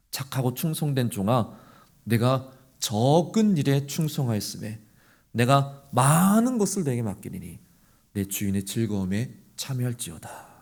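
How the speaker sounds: low, soft, solemn pronouncement; solemn blessing. Acted. Master commending the faithful servant, low and solemn. Jesus's solemn words quoted.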